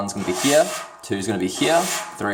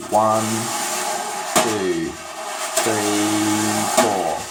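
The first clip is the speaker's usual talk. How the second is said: slow, spaced-out count, one beat at a time; much slower than usual. Shown as a demonstration. Drum teacher counting slowly to demonstrate the pattern, spaced and deliberate. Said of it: "Obviously that's not up-tempo, but I'm just showing you".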